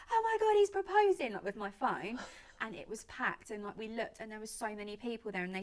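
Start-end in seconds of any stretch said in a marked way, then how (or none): 0.1-1.3 mock squeal, much higher and louder; a stranger squealing with excitement at a proposal; excited scream. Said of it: "she's, like, screaming".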